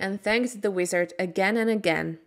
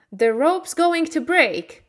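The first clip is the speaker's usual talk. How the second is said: higher, urgent warning; urgent shout; 8 semitones higher, 4 dB louder than usual. A narrator's reading. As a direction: warn her before the rope snaps. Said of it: "shouted the wizard".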